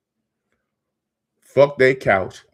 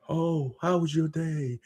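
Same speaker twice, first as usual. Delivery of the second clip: higher, soft, slow sing-song mocking of small talk; mock sweet greeting; 6 semitones higher, 6 dB quieter than usual. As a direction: sneer at the polite chit-chat with a sugary lilt. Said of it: "I'm not in the business to".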